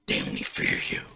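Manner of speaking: harsh, whispery villain growl; low rasping threat, spaced out phrase by phrase; menacing threat. Acted. Izuku threatening Shigaraki pinned to the wall, menacing rasp.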